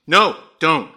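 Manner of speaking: sharp, high, loud objection. A narrator's reading. Wife stops husband going out to the car, sharply. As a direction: cut in firmly, voice jumping up. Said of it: "said Grace sharply".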